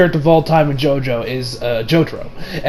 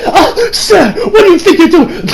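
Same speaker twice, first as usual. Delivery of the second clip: high-pitched mock protest; imitated scandalized squeal; mock shocked woman; 11 semitones higher than usual. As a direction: put on a flustered lady's high voice. Voicing the woman being licked.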